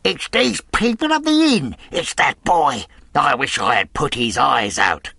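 angry, strained villain's shout; furious cry. A narrator's reading. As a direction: rage at having been outwitted. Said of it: "cried the blind man Pew".

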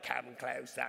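low British accent imitation. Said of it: "it had this British accent".

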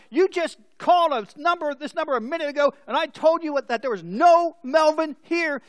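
heated reply. Acted. Man scolding a repeat wrong-number caller, heated. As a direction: tell the caller off loudly. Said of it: "said the heated reply".

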